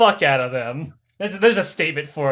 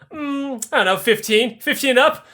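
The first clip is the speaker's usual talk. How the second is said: coy empress; 7 semitones higher than usual. Retold or imitated. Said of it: "He's like".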